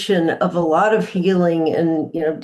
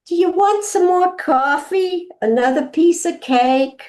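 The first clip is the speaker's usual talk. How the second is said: high, lightly voiced old New Orleans grandma offering more; a grandma's New Orleans accent, pitched up; 11 semitones higher than usual. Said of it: "in an old New Orleans accent".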